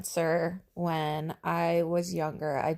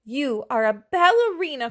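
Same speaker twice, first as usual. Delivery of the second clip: put-on louder, higher children's cry; children cheering the dinosaur on, higher and louder; 5 semitones higher, 6 dB louder than usual. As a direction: call it out proudly like kids. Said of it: "cried Clara and Jack".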